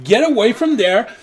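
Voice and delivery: high, loud, agitated command; scolding order. Acted. Shooing a gnome from the intercom, agitated scolding. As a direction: shoo them away sharply.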